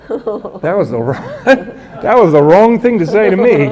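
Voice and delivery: real laughter breaking through the words; half-laughing at the memory. Real, not acted. Guard recalls the Pope's words to the interviewer, laughing.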